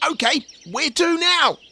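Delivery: keen to set off; Michael's voice. A narrator's reading. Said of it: "asked Michael".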